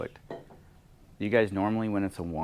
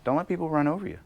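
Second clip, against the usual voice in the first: normal talking voice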